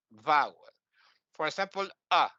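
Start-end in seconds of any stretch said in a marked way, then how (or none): 2.1-2.3 a short a, one beat only. Shown as a demonstration. Teaching students one matra, a short a. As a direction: clip the vowel a to a single beat. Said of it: "This is one matra".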